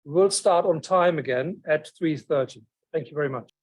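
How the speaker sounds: normal talking voice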